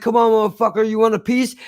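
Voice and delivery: mock thug taunt pitched high; lightly voiced jeering tough guy. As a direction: ham up a cocky goon picking a fight. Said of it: "they're like".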